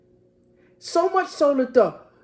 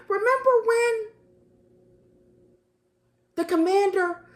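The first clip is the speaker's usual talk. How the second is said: much higher, near-crying pleading; high, emotional reminding with a catch in the throat; pleading Jesus; 7 semitones higher than usual. Acted. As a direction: plead with them to remember, voice climbing.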